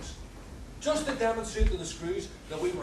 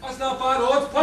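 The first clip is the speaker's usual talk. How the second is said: loud heckle; 7 semitones higher, 10 dB louder than usual. Acted. Punter heckles the odds.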